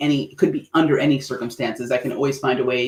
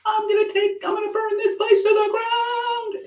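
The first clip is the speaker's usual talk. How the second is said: much higher, hammed-up furious rant; burn-it-down fake rage, pitched way up; mock fury. Coach showing audience what getting mad sounds like, hammed-up rage. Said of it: "You get mad and you're just like".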